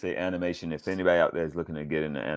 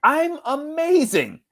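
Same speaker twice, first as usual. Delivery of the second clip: high, drawn-out self-praise; playful boast, pitched way up; mock boasting; 4 dB louder, slower than usual. Real, not acted. Voice actor answering a compliment, mock boasting.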